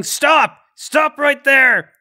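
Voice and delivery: loud, high command to halt; yelling. Acted. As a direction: yell it down a hallway at a fugitive. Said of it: "who's yelling".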